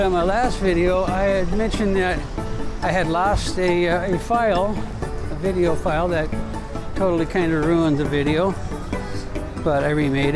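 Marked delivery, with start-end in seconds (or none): none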